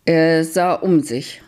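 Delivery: ordinary delivery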